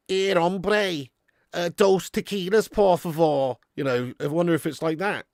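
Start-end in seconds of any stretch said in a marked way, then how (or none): 0.1-3.6 higher-pitched Scouser attempting Spanish; put-on Scouse Spanish; Scouse-accented Spanish. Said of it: "I wonder if he sounds Scouse in Spanish".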